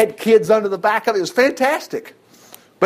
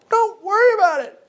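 carefree high; 13 semitones higher than usual. Acted. Repeated higher.

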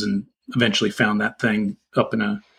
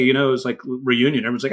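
normal talking voice

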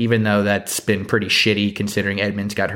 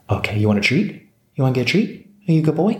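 talking to a dog; 4 semitones higher, faster than usual. Real, not acted. Praising the dog, offering treat.

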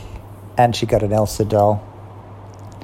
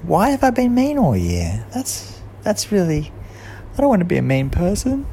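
higher, worried voice of Kat; 9 semitones higher than usual. A narrator's reading. Kat asking herself, higher and worried. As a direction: let Kat fret over being mean. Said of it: "Kat started to think".

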